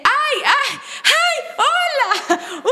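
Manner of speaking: very high fangirl squeals; mock excited scream. Actress reliving seeing his comment on her live, mock squealing. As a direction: squeal a starstruck greeting without real loudness. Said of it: "grité, dije".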